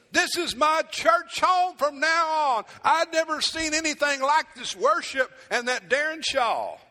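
overjoyed newcomer raving about the preacher and worship; gushing new churchgoer. Retold or imitated. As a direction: ham up the starry-eyed newcomer who found his church. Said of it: "They come in and say".

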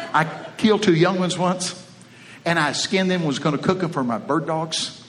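usual speaking voice

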